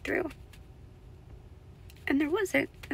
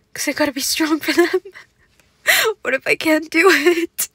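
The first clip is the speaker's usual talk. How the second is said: tearful, high and louder, laughing through tears; real crying while talking; 6 semitones higher, 12 dB louder than usual. Real, not acted. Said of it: "We're just crying together today".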